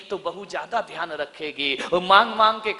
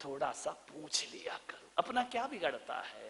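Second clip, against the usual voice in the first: whisper; 5 semitones lower, 13 dB quieter than usual. Real, not acted. Aside to daughters-in-law.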